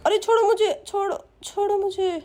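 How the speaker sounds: high-pitched protest while being grabbed; struggling victim. Acted. Father struggles against the thief.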